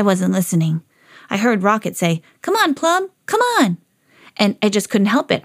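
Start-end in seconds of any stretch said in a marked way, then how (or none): 2.4-3.8 high, excited coaxing; playful high-pitched "come along" call; eager urging. Acted. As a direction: egg a friend on to join in. Dog friend urges Plum on.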